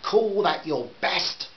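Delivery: mother sneering at father; scornful jibe. A narrator's reading. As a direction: stretch it out with contempt. Heated argument, mother mocking.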